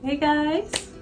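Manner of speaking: usual speaking voice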